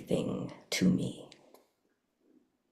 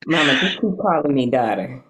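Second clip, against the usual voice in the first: a playful Southern accent, louder; 3 semitones higher, 5 dB louder than usual. Real, not acted. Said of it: "I like this, this Southern accent".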